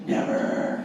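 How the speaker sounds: mock-defiant, voiceless rasp; taunting bully's refusal. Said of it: "They love it".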